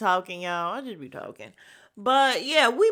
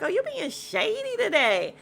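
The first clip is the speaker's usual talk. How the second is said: sassy quote; 11 semitones higher than usual. Retold or imitated. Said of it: "She was like".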